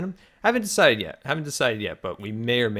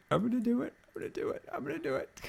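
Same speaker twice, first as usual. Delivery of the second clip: tiny high voice, fading softer; playful defiance; 13 semitones higher, 6 dB quieter, much faster than usual. Pretend. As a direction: squeak it out quietly, mock-sneaky. Playfully defying imagined objectors.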